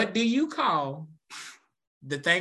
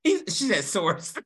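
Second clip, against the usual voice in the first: ordinary talk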